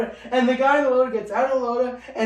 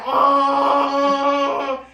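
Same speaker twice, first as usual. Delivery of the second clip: mock startled yelp; 5 semitones higher, 6 dB louder than usual. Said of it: "you can hear it".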